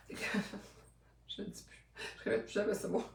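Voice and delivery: laughing. Real, not acted. Laughing at own flubbed brand name.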